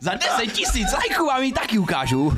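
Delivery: high squeaky promo voice, laughing; hammed-up kiddie streamer pitch; silly YouTuber voice. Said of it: "takhle mluvit".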